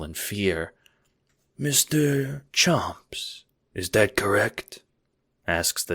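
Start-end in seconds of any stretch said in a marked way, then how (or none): 1.6-4.8 slow, hushed mob boss drawl; Godfather Don. A narrator's reading. Don questioning his underling, slow fake Godfather voice.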